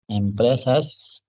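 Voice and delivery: stretched-out word, written along; slow dictation. Real, not acted. Teacher writing the next word for students, slow dictation. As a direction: say it slowly as you write.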